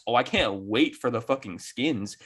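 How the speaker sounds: ordinary delivery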